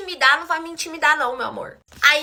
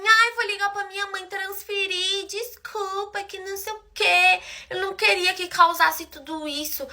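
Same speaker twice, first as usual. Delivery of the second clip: slow, higher-pitched whiny imitation; mock tearful pleading; 5 semitones higher, 4 dB quieter, slower than usual. Crying girl apologizing over the debt, mock tearful. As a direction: mimic her begging forgiveness, pitched up. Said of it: "Começou a chorar".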